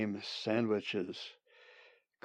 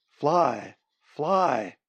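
raised, urgent command; mild shriek; 4 semitones higher, 5 dB louder than usual. A narrator's reading. Bird's brain urging escape, mildly shrieking. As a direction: cry the order out, slightly restrained. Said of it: "His brain was shrieking a single thought".

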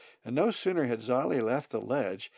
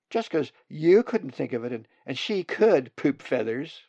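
put-on young bird voice, raised and loud, bickering; a chick sticking up for her sister, a bit higher; nestling squabble; 4 semitones higher, 9 dB louder than usual.

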